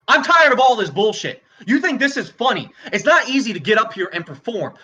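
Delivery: furious rant, flaring into shouts; angry tirade. Acted. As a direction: lash out at the whole crowd. Comedian loses temper at audience.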